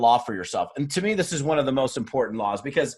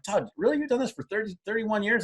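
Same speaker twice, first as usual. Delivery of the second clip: higher, softer, asking himself in disbelief; a playful double take at his own thirty years; mock amazement at himself; 7 semitones higher, 5 dB quieter than usual.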